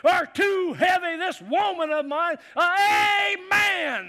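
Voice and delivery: slow, high, loud ranting; mock furious complaint. Pastor voicing the angry passenger ranting about heavy bags, slow and loud. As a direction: drag out the complaint in a rage.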